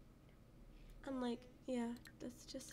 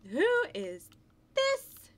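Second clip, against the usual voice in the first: much higher, loud gasping question; surprised gasp; 12 semitones higher, 10 dB louder, slower than usual. Real, not acted. Gamer gasping at a stranger met on a ride.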